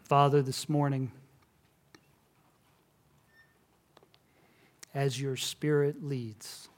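hushed, reverent pastoral prayer; closing prayer. Acted. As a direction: pray it gently over the congregation.

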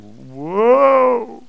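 high, loud startled gasp of a word; startled whoa. Retold or imitated. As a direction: recoil in surprise. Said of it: "we kind of go".

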